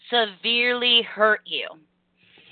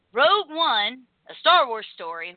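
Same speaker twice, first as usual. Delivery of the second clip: ordinary delivery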